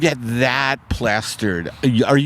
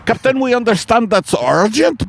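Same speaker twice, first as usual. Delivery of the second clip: high, urgent crewman's call; crewman's plea; 10 semitones higher, 3 dB louder than usual. Pretend. Submarine crewman calling up to the captain, high urgent. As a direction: plead with the captain.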